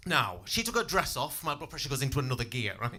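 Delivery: ordinary talk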